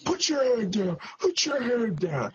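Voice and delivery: high-pitched imitation of the command; lightly voiced ringside instruction; mock referee shout. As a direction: parrot the order up high, half-joking. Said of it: "and all you hear is".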